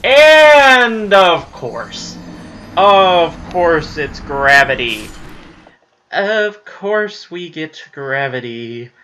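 loud, slow sarcastic drawl. Real, not acted. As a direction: drag it out sarcastically. Sarcastic groan at the gravity item.

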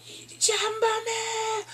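very high, slow squeal; mimicked drawn-out girlish cry; hammed-up girl impression. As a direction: stretch it out in a high put-on voice. Imitates infatuated girl.

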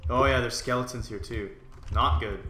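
normal talking voice

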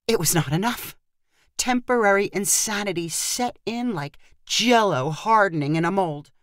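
ordinary delivery, nothing marked

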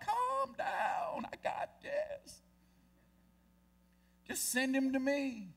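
very high falsetto, cracking, slowing down; cartoonish calm down, I got this; squeaky mock reassurance. Elisha soothing the panicked king in squeaky falsetto.

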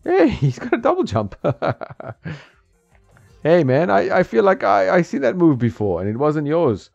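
ordinary talk

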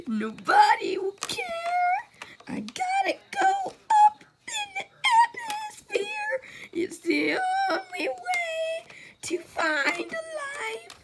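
very high, crying squeaky voice; ridiculous high voice. Pretend. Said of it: "I sound ridiculous and I don't care".

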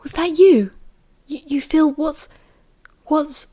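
halting, astonished stammer; overwhelmed. A narrator's reading. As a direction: stumble over it in amazement. Overwhelmed Sirius asks, faltering.

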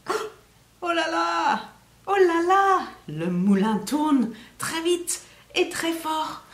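hammed-up alarm at the racing mill; playful surprise for the children; excited oh la la. Acting the mill speeding up.

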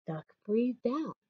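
usual speaking voice

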